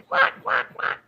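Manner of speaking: squeaky quack, quack, quack; high mock quacking. Mom voicing a bird to viewers, high mock quacks. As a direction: imitate a chattering bird.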